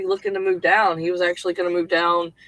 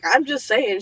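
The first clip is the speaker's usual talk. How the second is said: higher-pitched mock yell; Bob's imitated yelling; 7 semitones higher than usual. Uncle defending himself in an argument, imitated yelling. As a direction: protest in an imitation of his booming voice. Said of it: "you're just yelling".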